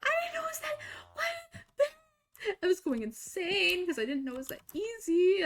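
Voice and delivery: high breaking whimper of frustration; crying disbelief. Real, not acted. Realizing how easy it was, near-crying whine.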